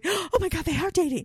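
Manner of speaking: high-pitched, hammed-up excitement; freaking out. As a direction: play a starstruck onlooker losing it. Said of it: "I'd be freaking out going".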